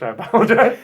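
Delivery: lightly done Scottish Willy Wonka apology; a quick Scottish Wonka sorry; light Scottish accent. Pretend. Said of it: "my Willy Wonka's Scottish".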